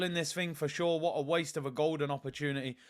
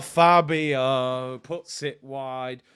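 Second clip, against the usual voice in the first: deflated; 6 semitones lower, 5 dB louder, much slower than usual. Real, not acted. Chance missed, deflated.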